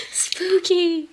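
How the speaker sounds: high, playful ooh-spooky tone; mock spooky. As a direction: ham up the spookiness for a laugh. Joking that spirits knocked it over.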